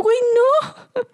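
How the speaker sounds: high, slow, loud protest; drawn-out protest. Retold or imitated. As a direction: stretch out an outraged no. Quoting her own protest.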